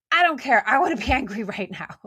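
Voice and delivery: a laughing mock tantrum; playfully stubborn I don't care; defiant tantrum. Reenacting her stubborn angry self.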